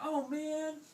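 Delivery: high, loud "aw man"; drawn-out, high letdown; disappointed swordfish. Acted. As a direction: whine it in cartoon dismay.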